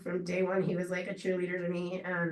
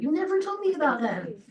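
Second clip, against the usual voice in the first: indignant protest; 8 semitones higher, 4 dB louder than usual. Retold or imitated. Arguing about Sonic slushes.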